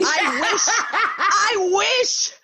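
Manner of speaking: loud, high laughing wish; laughing exclamation. Real, not acted. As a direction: burst out through laughter. Laughing outburst at being asked about drugs.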